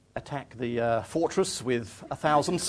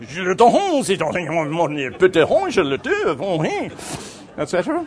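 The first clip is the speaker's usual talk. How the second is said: high, loud hammed-up French gabble; mock French babble; 5 semitones higher, 6 dB louder than usual. Frenchman by the canal, exaggerated French accent. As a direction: splutter like an excitable Frenchman. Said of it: "made all those extraordinary noises that French people make".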